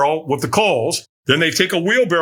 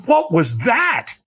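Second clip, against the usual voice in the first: higher, slow bewildered questions; baffled; 10 semitones higher, slower than usual. Retold or imitated. After the stranger vanishes she wonders aloud, bewildered. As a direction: puzzle over it, half amused.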